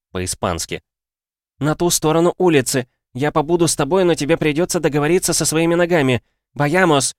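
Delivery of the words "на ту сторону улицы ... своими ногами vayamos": high, pressing voice; urgent urging. A narrator's reading. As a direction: get a child moving, pitched well up. Woman hurrying the boy across. (торопит, высоко и настойчиво)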